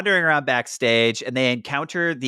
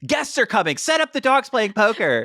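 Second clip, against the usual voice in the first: bossy mom; 8 semitones higher than usual. Retold or imitated. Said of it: "kicking open the daughter's door and being like".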